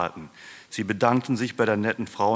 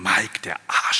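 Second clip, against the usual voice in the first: scolding; 5 dB louder than usual. A narrator's reading. Oma waits to celebrate without Mike, scolding. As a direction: curse the absent one with emphasis. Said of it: "schimpfte Oma".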